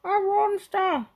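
very high, loud, slow dog wail; drawn-out alarmed talking dog; Scooby crying out. Acted. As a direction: wail it like a terrified cartoon dog. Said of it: "cried Scooby".

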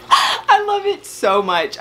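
high, drawn-out gush of love; real overwhelmed delight. Real, not acted. Overwhelmed by a surprise gift.

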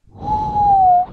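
huge high sigh of relief; loud relieved sigh. Real, not acted. Player escapes a chaser, big relieved sigh. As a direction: let all the tension out at once.

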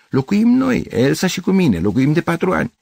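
high, emphatic protest; worked-up, pitched well up; indignant insistence. Acted. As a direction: insist on being in the right. Gavrilescu insists he lives here.

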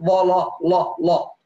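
لا لا لا with a full, dark velarized lam, slowly; dark lam drilled slowly; heavy lam. Shown as a demonstration. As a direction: thicken the lam in لا lā. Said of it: "ورش يفخم اللام".

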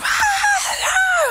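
a very high shrieking scream; imitated screech. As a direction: mimic the woman's panicked screech. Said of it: "like, screeching".

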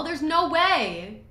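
slow, high, loud drawn-out disbelief; stunned disbelief. Real, not acted. Gamer refusing to believe what is on screen, stunned. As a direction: stretch it out, unable to believe it. Shocked at game scene.

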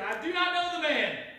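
high, loud, vehement outburst; cursing denial. Acted. Peter cursing as he denies Jesus, vehement. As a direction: swear it off forcefully. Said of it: "began to curse and swear".

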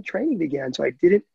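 normal talking voice

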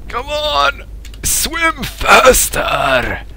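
high, strained, drawn-out plea; urgent urging. Real, not acted. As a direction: stretch it out high, willing them on. Urges swimmer on.

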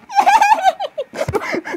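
very high-pitched giggle; high squealing laugh. Acted. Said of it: "you're laughing in a situation".

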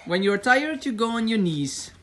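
normal talking voice

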